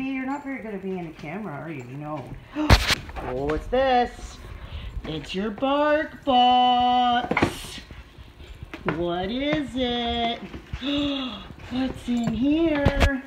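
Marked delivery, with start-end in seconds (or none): 2.3-3.2 loud, sharp gasp of surprise; sudden delighted gasp; excited gasp. Real, not acted. Hyping the dog's box. 3.3-13.2 slow, stretched-out excited questions; big slow wonder for a pup; excited pet talk. Real, not acted. Hyping the box to her dog.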